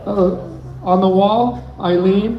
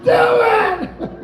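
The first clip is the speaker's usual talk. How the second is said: excited shout from the stage; 13 semitones higher than usual. Real, not acted. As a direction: yell it to fire him up. Hyping up a comic.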